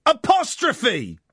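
usual speaking voice

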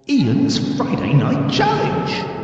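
slow announcer sign-off. Acted. Host signing off his new show, slow announcer read. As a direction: draw out the title like a jingle.

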